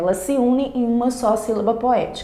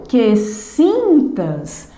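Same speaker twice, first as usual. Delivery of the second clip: "que sintas" very slowly, SIN stretched and raised; stress on SIN; 3 semitones higher, much slower than usual. Shown as a demonstration. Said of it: "Última sílaba tônica é o sim".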